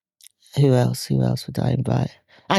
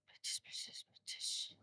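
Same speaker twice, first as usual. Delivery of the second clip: whispering; 30 dB quieter than usual. Real, not acted. Musing to herself over names.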